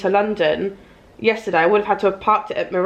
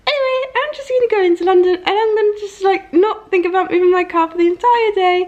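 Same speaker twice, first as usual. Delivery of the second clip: high, airy, breezy voice; carefree mock voice; 11 semitones higher, 4 dB louder than usual. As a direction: sing it out without a care.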